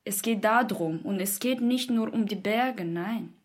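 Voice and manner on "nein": ordinary talk, nothing marked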